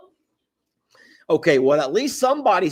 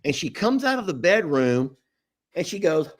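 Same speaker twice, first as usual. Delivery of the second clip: ordinary talk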